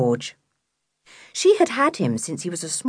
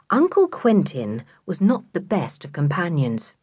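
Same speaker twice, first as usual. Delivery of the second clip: plain reading voice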